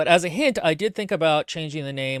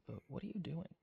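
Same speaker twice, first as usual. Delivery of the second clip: ordinary talk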